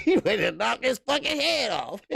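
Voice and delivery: words broken by laughter; laughing while talking. Real, not acted. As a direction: laugh through the line. Laughing while quoting himself.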